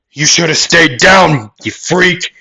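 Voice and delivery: high, loud fighter's insult; angry taunt. Acted. Fighter attacking the big guy who got up, shouting angrily. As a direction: hurl it at a fallen opponent.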